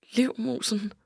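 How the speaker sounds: soft, private whisper of a nickname. A narrator's reading. Friend whispers Liv's pet name to herself, whispered. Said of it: "hvisker jeg".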